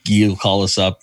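usual speaking voice

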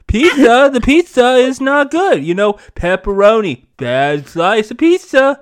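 loud, brash Trump voice with rising "folks". Acted. Friend finally does a loud Trump voice about pizza. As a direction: boom it out like a rally speech. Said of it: "I'll try to do a Trump voice".

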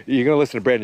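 normal talking voice

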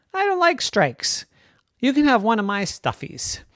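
put-on high, small little-boy voice; the youngest offering a toy, gently; littlest brother. Le petit frère parle.

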